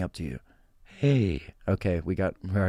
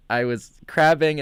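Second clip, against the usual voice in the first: ordinary talk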